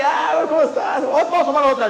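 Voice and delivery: high, shouted hail to a buddy; boisterous greeting. Acted. Man meets his compadre again, boisterous greeting. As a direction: call out as to an old drinking pal.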